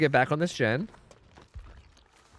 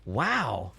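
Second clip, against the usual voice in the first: surprised; 11 semitones higher, much slower than usual. Real, not acted. Surprised a late hit landed.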